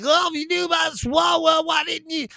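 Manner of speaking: high, loud mocking whine of his critics; mocking whiny critics. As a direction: ham up the outraged accusers, shrill and shouting. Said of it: "They're like".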